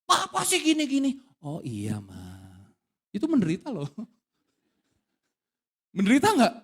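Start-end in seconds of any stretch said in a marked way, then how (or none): 0.0-1.2 wife's complaint pitched higher; wife scolding husband, raised pitch; high complaining wife. Retold or imitated. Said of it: "ketika pasanganmu tinggi". 1.4-2.4 much lower, softer reply; calm him down with a low soft answer; husband answering his wife, low and soft. Retold or imitated. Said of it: "kamu bisa kasih nada rendah?".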